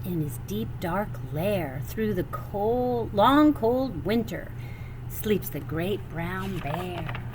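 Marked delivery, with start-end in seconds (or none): none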